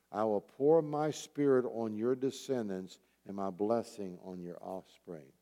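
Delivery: ordinary talk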